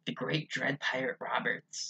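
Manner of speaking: slow dramatic whisper; hushed, slow storyteller build-up; whispered announcement. Acted. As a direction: whisper it like a legend.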